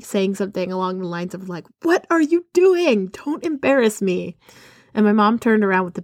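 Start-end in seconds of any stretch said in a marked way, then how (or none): 1.8-4.4 high, stretched-out retold teen protest; mock mortified scolding. Embarrassed teenage daughter scolds mom after the wave, mock mortified protest.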